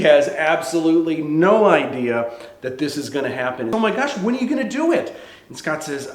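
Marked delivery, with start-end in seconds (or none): 3.7-5.2 high, fast, eager question; giddy excitement. Acted. Friend asking about the proposal, giddy excitement. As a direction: bubble over with the news.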